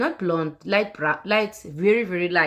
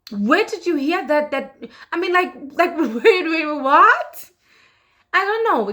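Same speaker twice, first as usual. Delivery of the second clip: incredulous laugh; 7 semitones higher, 5 dB louder than usual. Retold or imitated. Re-living shock, laughing in disbelief.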